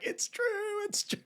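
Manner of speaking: high, wailing it's true; mock anguish. Retelling Marcus in bed hearing the news, high wail. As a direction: whimper it like a heartbroken friend. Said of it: "He's like".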